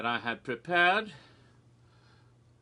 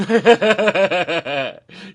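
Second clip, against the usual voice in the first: very loud, long cackle; loud laugh; 2 semitones higher, 12 dB louder than usual. Real, not acted. Host admitting he had no reason, cackling loudly. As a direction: cackle at your own silliness.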